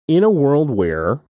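slow, dramatic trailer narrator; movie trailer voice. Pretend. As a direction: announce it like an epic trailer. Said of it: "It sounds like a movie trailer".

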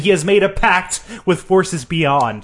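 high, overdramatic prophecy of doom; high-pitched melodramatic rant; mock dread. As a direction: warn of forbidden powers, hammed up. Mock doom rant about wizards.

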